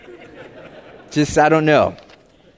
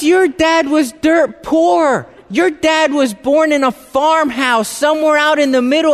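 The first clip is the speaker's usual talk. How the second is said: high, emphatic mom telling it plainly; mom's amused retelling; 9 semitones higher than usual. Retold or imitated. Mom amused at her son's question, high and emphatic. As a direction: laugh it off like it's obvious. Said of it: "she goes".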